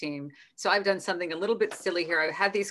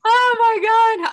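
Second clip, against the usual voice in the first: high, loud, drawn-out exclamation; delighted outburst; 14 semitones higher, 10 dB louder, slower than usual. Real, not acted. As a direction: burst out slowly in loud delight. Delighted host after the silly game.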